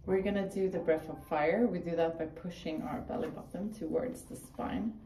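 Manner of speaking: calm class instructions; yoga instructor. Acted. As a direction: coach the breathing. Leads yoga exercises.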